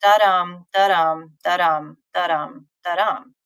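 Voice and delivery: "da-dum" with the stress on "dum"; sing-song "da-dum", second syllable stronger; sing-song beat. Shown as a demonstration. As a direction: chant each "da-dum" as an even rising iambic beat. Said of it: "if you're sing-songing it".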